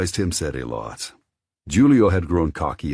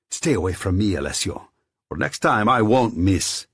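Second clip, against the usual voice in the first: angry threat; 6 semitones higher, 5 dB louder than usual. Acted. Threatening as he leaves.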